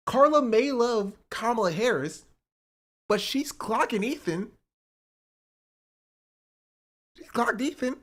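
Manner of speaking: high, slow hyped praise; excited. Real, not acted. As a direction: savor it like a burn landed.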